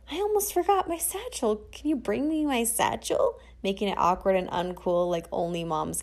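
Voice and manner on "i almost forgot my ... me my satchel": a lightly voiced mom, higher and fussy; imitating mom. Narrator lightly voicing her mom fussing about her satchel. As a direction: play the mom who calls it a satchel. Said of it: "Mom had this little hip bag that she'd exclusively call a satchel".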